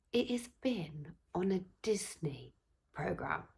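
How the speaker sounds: breathy secret whisper. Retold or imitated. Telling the seller in a thrilled whisper. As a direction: hush it like sharing a secret. Excited secret aside about lamp.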